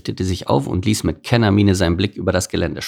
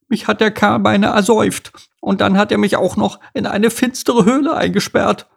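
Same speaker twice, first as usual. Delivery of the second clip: very high nasal bird voice; nasal blue tit; 13 semitones higher, 4 dB louder than usual. Acted. Sick blue tit accuses the beaver, nasal. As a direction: complain shrilly through a blocked nose. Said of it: "die näselnd rief".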